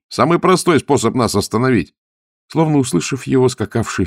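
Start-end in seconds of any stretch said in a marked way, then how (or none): 0.1-1.9 raised, higher voice; shouted remark. A narrator's reading. Zagorsky explains the pursuers' easiest option, shouting. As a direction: keep calling out over the gallop. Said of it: "крикнул Загорский".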